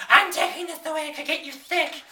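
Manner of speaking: high-pitched mock angry rant; hammed-up fit of crazed anger. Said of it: "takes the cat away in a crazed anger".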